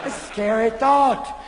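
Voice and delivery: usual speaking voice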